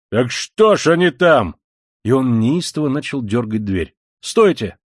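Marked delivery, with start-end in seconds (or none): 0.1-1.6 loud, slow indignant question; astonished shout. A narrator's reading. Koch baffled by the locked door, loud astonished shout. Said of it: "закричал удивившийся Кох". 4.2-4.8 loud, raised command to stop; shouted halt. A narrator's reading. Young man stopping Koch at the door, loud shout. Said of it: "закричал опять молодой человек".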